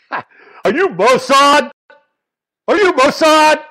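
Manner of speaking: retold furious yell. Radio host yelling at a hawkish caller, furious shout. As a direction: hurl the question at the caller like an accusation. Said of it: "Michael Savage just started yelling at him".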